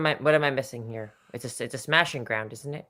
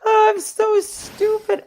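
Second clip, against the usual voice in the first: laughing; 6 dB louder, much slower than usual. Real, not acted. Laughing at own mistake.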